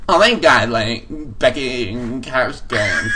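put-on high squealing sorority girl; giddy party girl. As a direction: gush like a valley girl. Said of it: "like a sorority girl".

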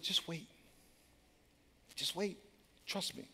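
soft, hushed promise; quiet reassurance. Retold or imitated. God quietly reassures the player, hushed. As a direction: murmur it calmly as God.

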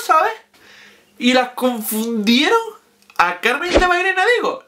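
ordinary talk

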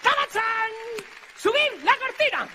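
very high, loud showman's shout; hammed-up magician's big reveal; announcing by microphone. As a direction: shout stage commands at full pitch. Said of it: "va a decir por el micro".

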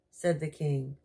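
ordinary delivery, nothing marked

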